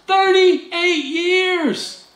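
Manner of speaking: very slow, high, stretched out; drawn-out stress. Real, not acted. Pastor stressing to the congregation how long the man waited, drawn out. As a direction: lean on every syllable.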